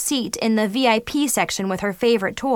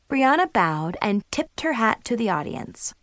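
usual speaking voice

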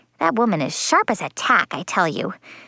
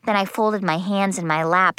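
ordinary delivery, nothing marked

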